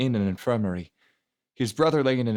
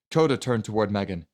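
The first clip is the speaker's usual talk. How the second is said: plain reading voice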